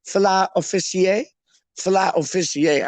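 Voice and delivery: mock-French fala officier, ending in "ee-ay"; posh pronunciation. Shown as a demonstration. Host invents posh order, "fala officier". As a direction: turn fish into a French-sounding officier. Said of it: "That sounds better".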